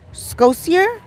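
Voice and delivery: Scotier asked with a high rising tune; baffled, repeat Scotier as a question; puzzled question. Real, not acted. Puzzling over a chat word.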